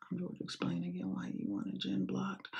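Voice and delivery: a mocking stage whisper. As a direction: whisper it snidely, as a taunt. Mocking the clip's speaker.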